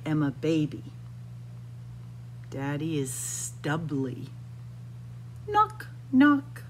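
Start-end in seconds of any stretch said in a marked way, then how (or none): none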